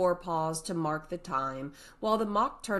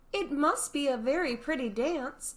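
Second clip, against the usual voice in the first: timid; 9 semitones higher, 3 dB louder than usual. Acted. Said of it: "said Alice timidly".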